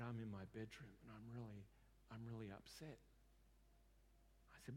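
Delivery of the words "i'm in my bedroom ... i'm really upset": low, soft, subdued retelling of distress; upset client, retold. Upset client tells counsellor what he remembers, soft and subdued. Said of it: "He just burst out crying".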